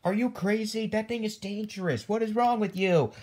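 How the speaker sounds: high, heated telling-off; outraged scolding. Acted. Scolding the one who brought an alligator in, outraged.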